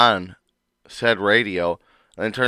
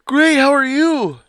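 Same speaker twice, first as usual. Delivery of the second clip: high-pitched voice; 6 dB louder, slower than usual. Acted. Acted phone call with wife.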